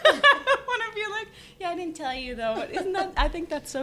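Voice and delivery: giggling, high-pitched mock reveal; laughing. Owner imagining revealing herself to a shopper, giggling. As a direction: laugh through the awkward confession.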